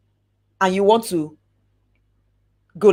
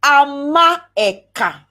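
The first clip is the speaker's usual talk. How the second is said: "Amaeka" said slowly, a little louder, a-ma-e-ka; slow clear name; 3 semitones higher, 5 dB louder, much slower than usual. Shown as a demonstration. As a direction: spell out "Amaeka" with your voice. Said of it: "Is it Ibok or Ibok?".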